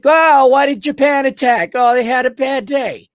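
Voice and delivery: high, loud sarcastic sing-song; sarcastic mock naivety. Mocking a commenter's naive history, high sarcastic voice. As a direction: ridicule the naive question.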